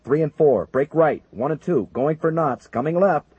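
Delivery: urgent orders. Acted. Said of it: "O'Neill ordered".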